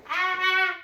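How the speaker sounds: high loud happy squeal; imitated kid squeal. Imitating the toddler squealing during the chase, high and loud. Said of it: "And he's like".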